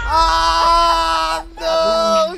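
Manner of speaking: dismayed cry. Real, not acted. Lost the round again.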